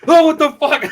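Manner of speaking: loud, high startled outburst; shocked yell, acted out. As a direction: re-enact being jolted awake by a scream. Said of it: "it startled me".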